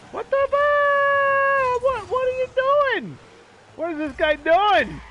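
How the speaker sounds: high, loud outburst of disbelief; exasperated yell. Real, not acted. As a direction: yell in outrage at a reckless opponent. Yelling at opponent during game.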